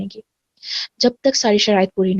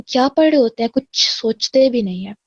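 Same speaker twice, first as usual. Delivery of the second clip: ordinary delivery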